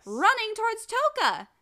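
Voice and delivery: high, loud burst of alarm; excited storyteller's cry; dramatic urgency. Real, not acted. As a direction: raise pitch and volume for the big moment. Urgent climax of the retelling.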